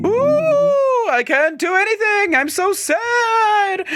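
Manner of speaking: high, slow, drawn-out spectral voice; ghost voice. Acted. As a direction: intone it like a solemn ghost. Ghost voice continues dialogue.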